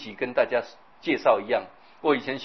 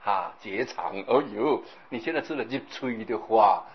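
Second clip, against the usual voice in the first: ordinary talk, nothing marked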